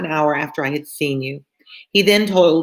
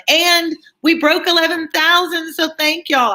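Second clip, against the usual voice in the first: bursting up high with delight; excited thanks; 12 semitones higher than usual. Real, not acted. Streamer thanking viewers for a milestone, excited. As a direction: share the good news gleefully.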